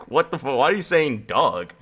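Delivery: ordinary talk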